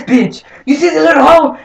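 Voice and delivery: ordinary talk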